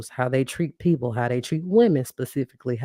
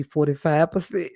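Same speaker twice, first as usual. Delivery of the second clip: laughing through it; 2 semitones higher than usual. Real, not acted. Laughs through rapper punchline.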